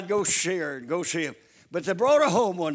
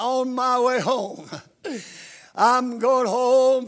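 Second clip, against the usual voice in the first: louder, slow, stretched out in joy; joyful slow proclaim; 3 semitones higher, 3 dB louder, slower than usual. Real, not acted. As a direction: savor each word of going home. Preacher exults about heaven.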